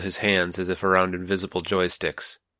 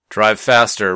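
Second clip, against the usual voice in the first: a much louder urgent order; louder order to the driver as ice closes in; sharp command; 7 dB louder, slower than usual. Acted. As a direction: bark it at the driver. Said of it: "Ray commanded".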